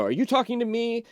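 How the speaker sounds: put-on a touch of offended farm-boy pride; indignant. Young man answering the insult, mildly indignant. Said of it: "gets very indignant and says".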